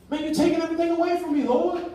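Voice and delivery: high, fast, pleading protest; exasperated complaint. Retold or imitated. Gideon protesting to the Lord, high and fast exasperation. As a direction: whine it up to the Lord, feeling robbed.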